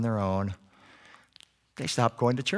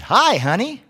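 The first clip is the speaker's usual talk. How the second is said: high, louder parrot flirt; flirty parrot; 9 semitones higher, 8 dB louder, slower than usual. Acted. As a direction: squawk a cheeky come-on. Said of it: "gave a wolf whistle and said".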